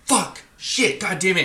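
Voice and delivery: high, exasperated swearing at the car; frustrated cursing. Retold or imitated. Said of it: "was like".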